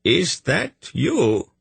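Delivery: high, slow, wavering call; quavering old man. Acted. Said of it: "he called in a quavering voice".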